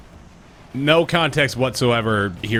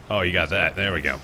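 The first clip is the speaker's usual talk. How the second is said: ordinary delivery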